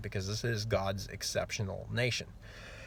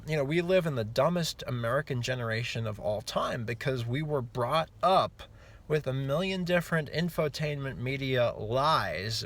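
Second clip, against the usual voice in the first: ordinary talk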